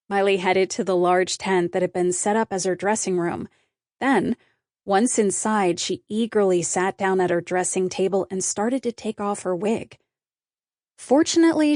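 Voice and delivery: plain reading voice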